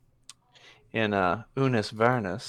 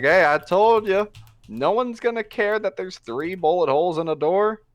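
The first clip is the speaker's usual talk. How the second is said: higher and louder cocky bragging; boastful shout; 8 semitones higher, 4 dB louder than usual. Acted. Gunman bragging to the saloon crowd, loud and cocky. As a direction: rub it in like a swaggering gunslinger.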